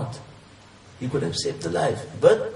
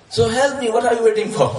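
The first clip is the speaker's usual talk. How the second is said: higher and louder, pleading impatiently; desperate shout; 9 semitones higher, 8 dB louder than usual. Acted. Hanging climber shouting up at God, desperate. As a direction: yell for help from the rope.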